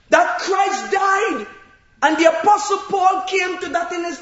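loud, high, shouting preacher storytelling; preaching at full force. Acted. Preacher to congregation about Jonah, loud full-force preaching. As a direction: build the congregation up with each line.

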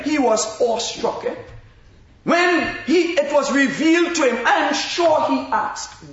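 rising, ringing sermon voice with big stressed words; preaching. Acted. Preacher stirring the saints to awe. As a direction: preach it out to the congregation with fire.

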